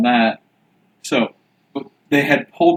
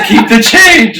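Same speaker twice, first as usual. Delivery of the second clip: louder, higher mock panicked shout; retold yell; 7 semitones higher, 8 dB louder than usual. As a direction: yell it like a rattled driver. Retelling panicked driver yelling at him.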